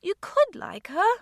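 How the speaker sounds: put-on girl pleading with her guardian; pleading child. A narrator's reading. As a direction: plead with him gently. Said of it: "almost pleadingly".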